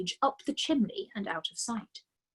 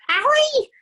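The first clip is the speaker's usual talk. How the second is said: a much higher, louder startled cry; 12 dB louder than usual. A narrator's reading. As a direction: shriek in alarm. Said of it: "cried the mother".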